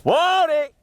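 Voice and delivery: shocked high yelp; blurted out in surprise; surprised. Real, not acted. Sudden shock during stunt.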